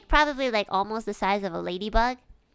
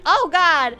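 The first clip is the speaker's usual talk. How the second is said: loud, high dismayed exclamation; retold disgusted shock; 7 semitones higher, 10 dB louder than usual. Teacher seeing the bug splatted, loud retold shock. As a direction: recoil aloud, re-enacting it.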